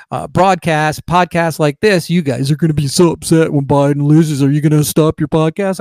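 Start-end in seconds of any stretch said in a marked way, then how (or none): 2.1-5.8 lightly voiced smug taunt from the left; mocking leftist critic. Leftist pundits taunting right-wing podcasters, lightly mocked voice. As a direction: mimic a gloating critic needling him. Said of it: "the left will say".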